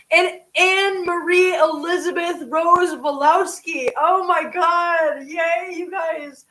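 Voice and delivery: high, drawn-out happy cheering; delighted cheer. Real, not acted. Streamer cheers viewers who caught memberships, delighted. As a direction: stretch the words out in real delight.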